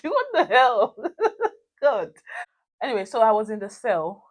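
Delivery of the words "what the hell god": high laughing disbelief. Real, not acted. Marvelling at how wicked people are, laughing. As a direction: laugh it out, higher.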